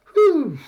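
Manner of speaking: sharp high loud exhale of relief; relieved whew. Real, not acted. Host relieved after the winner pick, loud whew. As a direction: blow out the tension in one loud breath.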